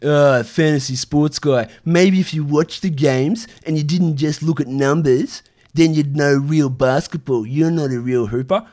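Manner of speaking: slow, put-on sneering hater voice; mocking critics' shade. Streamer imitates critics of fantasy sports fans, mock-condescending. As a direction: imitate a scornful know-it-all, dragging the words. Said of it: "will come in with, you know, shade".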